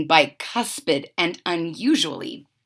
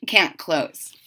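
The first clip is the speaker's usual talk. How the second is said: ordinary delivery, nothing marked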